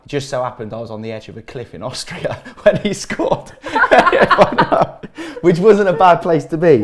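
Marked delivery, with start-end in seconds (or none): 3.6-5.4 high loud laugh; burst of laughter. Real, not acted. [name] laughing at the cliff story, loud high laugh.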